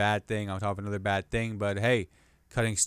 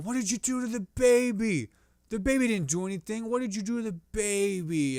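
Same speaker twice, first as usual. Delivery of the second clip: accusing bystander; 9 semitones higher than usual. Acted.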